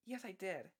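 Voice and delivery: high-pitched feminine prank voice; put-on feminine voice. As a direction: answer back in a put-on woman's voice. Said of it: "this voice to have this".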